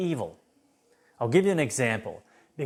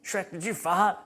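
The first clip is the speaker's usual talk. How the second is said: higher, nosy Donkey asking; higher cheeky Donkey voice; 8 semitones higher than usual. Retold or imitated. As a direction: poke Shrek with a cheeky question. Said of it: "Donkey says to Shrek".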